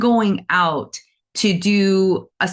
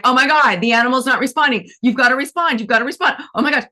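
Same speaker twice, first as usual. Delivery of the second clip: mock panic; 7 semitones higher, faster than usual. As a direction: beg the animal in a hammed-up panic. Panicking healer begging animal.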